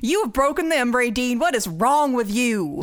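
mild put-on Southern scold; lightly Southern mama scolding; Southern accent. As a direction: scold Ray Dean in a hammed-up Southern twang. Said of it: "you gotta say it in the right accent".

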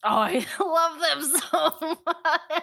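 high, slow, laughing delight; excited gush. Real, not acted. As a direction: gush with a laugh over fans' favourites. Gushing about a favourite tag team.

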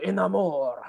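mock French pronunciation of amour; French accent. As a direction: say amour the French way, nasal and drawn. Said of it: "I said amour real, in a French accent".

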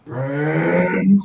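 loud, drawn-out monster growl, rising; big beast asking, pitch climbing; questioning creature. Acted. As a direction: roar it as an uncertain question. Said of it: "looks around and goes".

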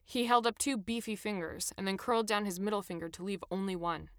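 usual speaking voice

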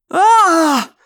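long, high, loud scream; agonised cry. A narrator's reading. Dying controller cries out, screaming. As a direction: scream out in pain. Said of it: "the man cried".

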